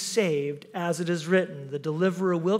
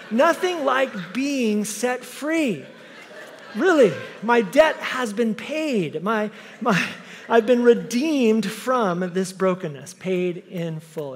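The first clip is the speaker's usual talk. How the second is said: preach; 4 semitones higher, 4 dB louder, slower than usual. Real, not acted. Preaching redemption.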